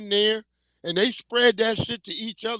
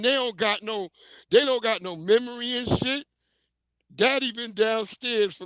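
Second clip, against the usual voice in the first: usual speaking voice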